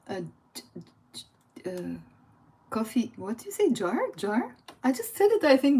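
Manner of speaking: ordinary delivery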